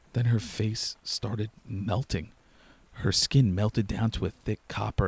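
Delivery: ordinary delivery, nothing marked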